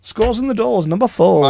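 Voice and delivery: sing-song bingo call; bingo caller. Pretend. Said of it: "I could have been a bingo caller".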